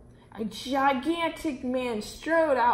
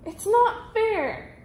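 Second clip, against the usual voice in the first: much higher, very slow tantrum wail; bigger whine; 10 semitones higher, 3 dB louder, much slower than usual. A narrator's reading. Grand Duke throwing a tantrum, much higher and very slow. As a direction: wail it out like a spoiled child. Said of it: "cried the Grand Duke".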